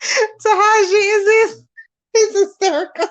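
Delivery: high, tearful laughter through the words; crying with laughter while talking; hysterical laughing. Real, not acted. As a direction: squeal it out, helpless with laughter. Helpless laughing over viewer comment.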